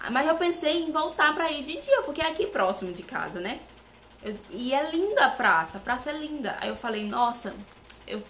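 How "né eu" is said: ordinary delivery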